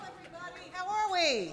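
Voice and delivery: calling out. Real, not acted. Host greets the crowd.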